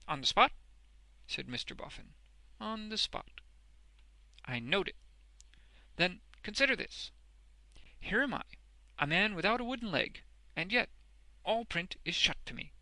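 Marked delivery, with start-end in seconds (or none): 0.1-0.5 loud, startled burst; astonished question. A narrator's reading. Boffin questions Wegg's boast, astonished. 2.6-3.4 high, composed answer; assured confirmation. A narrator's reading. Wegg confirms his boast, assured. 4.4-12.7 high, loud rustic character voice; emphatic, earnest Boffin. A narrator's reading. Boffin confesses he cannot read, earnestly.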